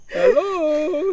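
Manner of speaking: high, loud hammed-up Italian greeting; loud Italian hello. Humdinger shouting at the drive-thru speaker in an Italian accent. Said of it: "he shouted".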